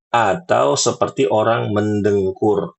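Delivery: ordinary talk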